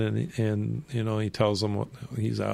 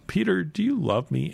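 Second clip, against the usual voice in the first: much higher, searching question; pressing higher question; 8 semitones higher than usual. Retold or imitated. Jesus asking Peter a third time, high and pressing. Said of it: "the third time Jesus asked him".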